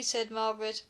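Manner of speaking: plain reading voice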